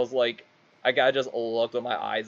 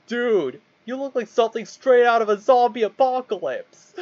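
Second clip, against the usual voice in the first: high, loud, excited outburst; mock amazed yell; 10 semitones higher, 6 dB louder than usual. Friend going up to the hobo, loud amazed yell. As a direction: blurt it at him, pitched way up.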